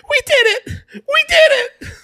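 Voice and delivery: put-on very high squealing pre-puberty boy; Devon Sawa. Boy celebrating beating the Cowboys, very high girlish squeal. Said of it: "hadn't hit puberty and talked like a girl".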